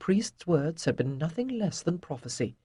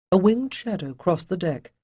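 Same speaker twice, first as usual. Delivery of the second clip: ordinary delivery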